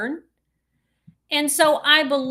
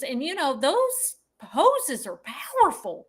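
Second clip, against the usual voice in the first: normal talking voice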